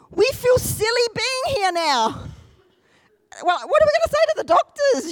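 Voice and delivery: high, giddy imitated wife; comic high-pitched retelling of her fluster. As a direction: squeal it in mock embarrassment. Acts embarrassed, delighted wife.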